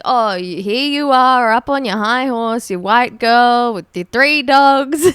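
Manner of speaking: mock someone as high and mighty, slowing down; mock accusation. Acting out the stranger's accusation.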